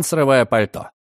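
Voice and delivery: ordinary delivery, nothing marked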